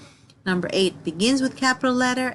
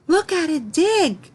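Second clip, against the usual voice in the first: much higher, louder, slow excited cry; excited exclamation; 9 semitones higher, 5 dB louder than usual. Shown as a demonstration. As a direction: call out in delight at the digging dog. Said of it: "we say this with excitement".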